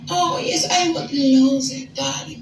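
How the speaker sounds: put-on high, softening French accent fading on darling; fake French accent. Woman sweetly agreeing with her date in a fake French accent. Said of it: "I spoke in a French accent the entire time".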